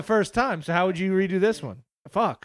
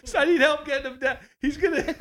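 higher, panicked and laughing; mock-panicked crowd calling out; frantic bystanders; 8 semitones higher than usual. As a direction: yell for help while cracking up. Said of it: "They're like".

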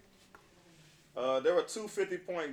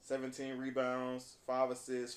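normal talking voice

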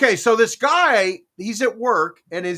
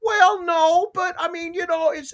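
much higher mock excuse voice; retold sheepish backpedal; 11 semitones higher than usual. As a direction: imitate someone squirming out of an accusation. Acting out woman's feeble excuse.

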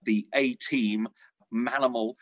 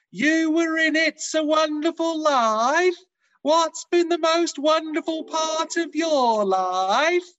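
put-on loud, high, slow shout at an elderly listener; shouted questions; 7 dB louder, slower than usual. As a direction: shout it slowly, as if they're hard of hearing. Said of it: "by shouting my questions. It went like this".

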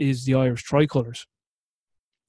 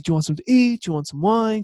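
higher, eager offers tumbling out; lively hostess offering food and wine, pitched up; eager rapid host; 7 semitones higher, faster than usual. Retold or imitated. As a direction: play the hostess rushing to please. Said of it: "hundred miles an hour".

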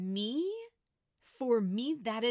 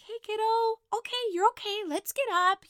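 light, high, breezy reassurance; calm reassuring mom; 13 semitones higher, 6 dB louder, faster than usual. Retold or imitated. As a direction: brush it off cheerfully for the kid, pitched up. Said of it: "I've also gone over and been like".

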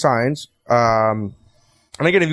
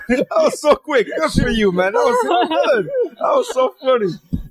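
much higher, laughing praise; delighted laughter at a quick quip; laughing hard; 11 semitones higher than usual. Real, not acted. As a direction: crack up while praising the joke. Laughing at a friend's joke.

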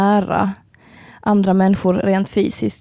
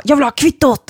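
loud, raised demand of an angry customer; verbal attack; 7 semitones higher, 5 dB louder than usual. Retold or imitated. Drunk customer demands his receipt at the bar, aggressive. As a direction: imitate a drunk man going for the bartender. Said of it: "attackera mig verbalt".

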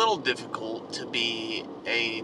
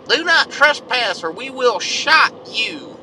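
mock hillbilly threat, slow and loud; 11 semitones higher, 8 dB louder, slower than usual. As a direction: bellow the sign like an armed backwoods neighbor. Sign read in redneck voice.

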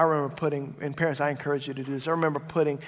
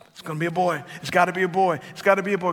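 rapid-fire mock insistence, a little louder; insistent; 3 semitones higher, 5 dB louder, much faster than usual. Dad recalling his hope for a boy, insistent. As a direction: repeat it like a stubborn conviction.

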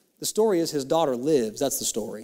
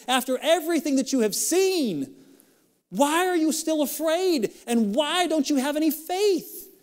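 pleading high question; 12 semitones higher than usual. Acted. Jesus asks disciples.

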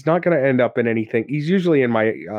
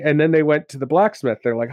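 normal talking voice

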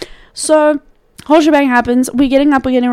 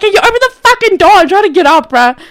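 higher, louder, fast mock yell; retold yell; 6 semitones higher, 6 dB louder, faster than usual. Brother yells at Chris through the door, loud mock yell. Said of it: "Bob screams at Chris".

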